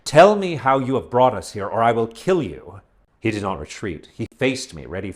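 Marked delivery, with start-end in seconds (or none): 0.0-2.8 louder, snarling demand; snarling threat. A narrator's reading. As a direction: snarl it like a cornered animal. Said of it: "the snarl of an animal in my own voice".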